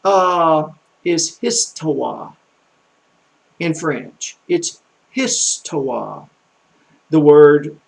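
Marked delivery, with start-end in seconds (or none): 1.4-2.3 histoire drawn out slowly, "ees-twah"; slowed down. Shown as a demonstration. Teacher giving French word for history, histoire slow and stretched. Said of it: "try to slow down a little bit now". 5.1-6.3 histoire stretched slowly, syllable by syllable; slowed down. Shown as a demonstration. Teacher repeating the French for history, histoire slow and drawn out. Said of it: "try to slow down a little bit now".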